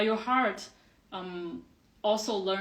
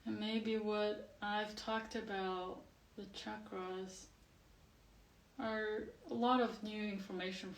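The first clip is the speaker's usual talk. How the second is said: guided meditation; 8 dB quieter than usual. Acted. Teacher settles class with eyes closed, guided meditation. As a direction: gently guide them into the body, softly and unhurried.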